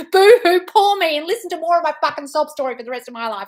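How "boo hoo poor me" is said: high, loud mock boo-hoo; mock sobbing. Host mocking a viewer crying to their shrink, hammed-up sob. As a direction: ham up a fake crybaby wail. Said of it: "you'll be like".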